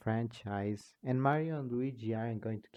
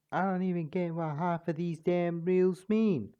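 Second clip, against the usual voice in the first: a higher, louder attempt at the gruff king; failed King Dedede voice; 5 semitones higher, 3 dB louder than usual. A narrator missing King Dedede's voice, a bit higher. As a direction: push it up and a bit louder, not quite landing. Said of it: "That's not his voice".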